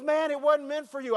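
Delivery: put-on high, sheepish kid's voice; teen boy voice. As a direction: play the guilty teenager dodging the question. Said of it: "He goes".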